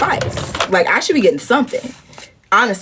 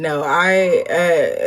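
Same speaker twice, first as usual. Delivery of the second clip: ordinary talk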